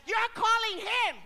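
loud, high preaching in Spanish and English; full-force preaching. Acted. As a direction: preach it out to the whole church. Preacher addressing congregation.

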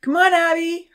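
high, loud call from a distance. Acted. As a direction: call across the hall. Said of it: "her mom called out from a distance".

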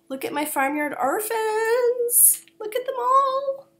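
squealing excitement. Retold or imitated. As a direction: coo over the yarn like a proud owner. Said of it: "my partner in crime texting me".

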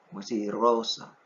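ordinary delivery

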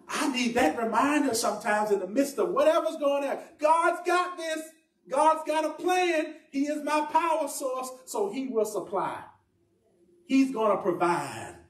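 high, drawn-out pulpit declarations; impassioned preaching. Real, not acted. Preacher reminding congregation of God's plan, impassioned. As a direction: preach it high, stretching each phrase.

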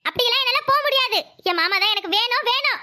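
ordinary talk, nothing marked

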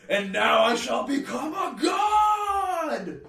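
slow, high, loud booming proclamation; grandiose villain. Retold or imitated. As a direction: declare godhood like a hammy sorcerer. Wizard's triumphant ritual line retold.

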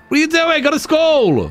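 hammed-up nagging mom; mock scolding parent. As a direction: shoo the kid off to school. Scolding kid who won't go.

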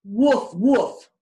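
odd loud barking frog. Acted. Frog answering Becky with a loud mock bark. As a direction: bark it back like a cheeky frog. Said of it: "the frog said".

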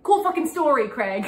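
a retold sarcastic shout; sarcastic retort. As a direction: throw it back with mock sarcasm. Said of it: "but he said".

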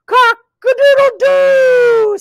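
loud, high, drawn-out rooster crow; crowing cat. A narrator's reading. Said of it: "said the cat".

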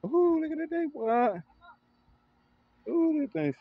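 high excited ooh; delighted ooh. Real, not acted. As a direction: marvel at the smoking grill. Admiring the grill smoke.

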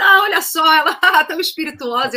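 high, loud laughing quote; laughing mockery. Crew laughing at the singer's joke, loud and high. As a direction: imitate the crew laughing at her. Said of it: "todo mundo caiu na gargalhada".

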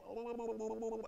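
wobbly drawn-out "oh-oh-oh"; mock struggling wail. Believer buckling under the load, wobbling mock wail. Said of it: "you're going".

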